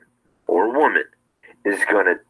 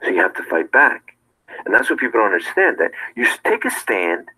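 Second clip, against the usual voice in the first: ordinary talk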